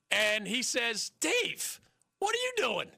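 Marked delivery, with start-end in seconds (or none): 1.2-2.9 much higher, startled question; startled dad. Retold or imitated. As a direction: sound caught off guard by your kid. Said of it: "and he's startled".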